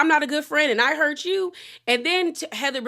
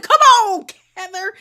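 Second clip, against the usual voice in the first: much higher, much louder shout; yelling at the screen; 9 semitones higher, 10 dB louder than usual. Retold or imitated. Vlogger yelling at Heather on screen, loud shout. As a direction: call out at her like a fan at the TV.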